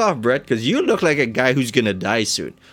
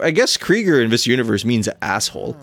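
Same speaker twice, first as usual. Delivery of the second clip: ordinary talk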